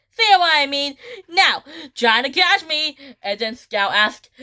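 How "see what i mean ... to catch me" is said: high, louder villain taunt. Acted. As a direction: act out a challenge, pitched up. Scout taunts her chasers.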